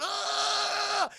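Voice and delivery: high, strained cry of pain; villain crying out as his suit is melted; pained scream. Acted. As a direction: yell as the heat melts your suit. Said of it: "Victor Freeze is going".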